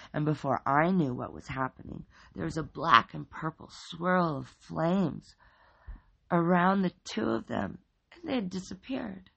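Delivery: plain reading voice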